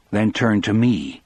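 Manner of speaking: ordinary delivery, nothing marked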